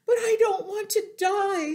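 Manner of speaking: very high, loud crying protest; wailing scream. A narrator's reading. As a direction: scream through tears as a piglet. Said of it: "screamed Wilbur".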